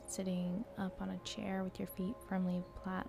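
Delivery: soft, hushed meditation voice; guided meditation. Acted.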